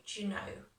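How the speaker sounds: "Do you know" contracted to "d'you know"; merged, natural. Shown as a demonstration. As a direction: blend "Do you" into one short syllable. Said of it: "that will sound really nice and natural".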